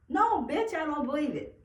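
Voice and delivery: high, loud, angry comeback; indignant outburst; defiant refusal. Acted. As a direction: throw it back, high and forceful.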